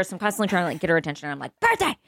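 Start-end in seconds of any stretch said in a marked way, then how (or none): 1.6-1.9 high sing-song calling out, higher pitch; calling the dog. Retold or imitated. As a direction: call out to a near-deaf dog, pitched up high. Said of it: "yelling the word birthday".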